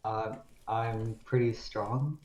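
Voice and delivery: downplay it flatly; quiet, slow deadpan brag. Acted. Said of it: "looked at him and said".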